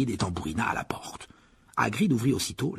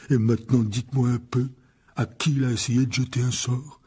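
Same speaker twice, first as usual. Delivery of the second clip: grumbling gamekeeper. A narrator's reading. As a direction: grumble heavily about a know-it-all. Voices giant gamekeeper Hagrid. (garde-chasse bourru qui ronchonne)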